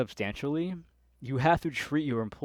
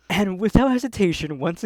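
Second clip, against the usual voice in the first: higher and louder, fed up; exasperated; 7 semitones higher, 10 dB louder than usual. Real, not acted. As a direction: say it fed up and loud. Rising exasperation about manager.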